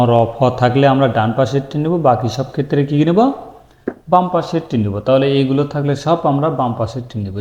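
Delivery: normal talking voice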